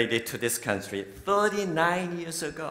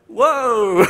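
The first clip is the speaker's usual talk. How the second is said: high, loud, laughing whoa; impressed whoa; 9 semitones higher, 9 dB louder than usual. Shown as a demonstration. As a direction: joke exclamation of being impressed. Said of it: "Do you know how to say".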